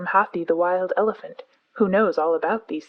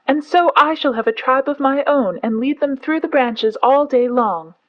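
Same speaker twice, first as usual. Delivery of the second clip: much higher and louder, full shout; 6 semitones higher, 6 dB louder than usual. A narrator's reading. Mowgli trying to be heard, shouting at the top of his voice. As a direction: yell it over the others. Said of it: "shouting at the top of his voice".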